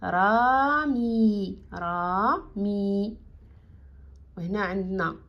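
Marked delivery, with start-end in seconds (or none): 0.0-3.2 رامي stretched very slowly, said twice; slow spelled-out word. Shown as a demonstration. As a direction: draw رامي out for beginners. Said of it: "غتعطينا كلمة".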